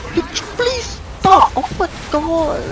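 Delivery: high, slow pleading whine; exasperated friend. Retold or imitated. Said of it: "And Quan was just like".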